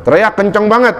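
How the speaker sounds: high-pitched, alarmed questioning; startled friend. Acted. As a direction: ask in surprise, pitch jumping up. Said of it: "kaget yang punya rumah".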